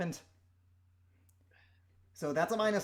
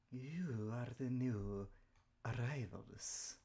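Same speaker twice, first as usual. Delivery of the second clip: slow hissing serpent whisper; sinister snake cult voice; 5 semitones lower, 7 dB quieter, slower than usual. Acted. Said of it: "I have to speak with the snake voice".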